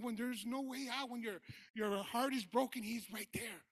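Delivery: loud, high preacher's cry, slowing to a shout; rising sermon shout; fiery preaching. Acted. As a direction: preach it out to the congregation at full force. Preaching to the congregation.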